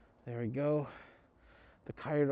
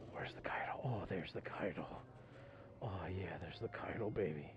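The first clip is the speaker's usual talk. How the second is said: whisper; 7 semitones lower, 13 dB quieter than usual. Real, not acted. Whispering inside the store.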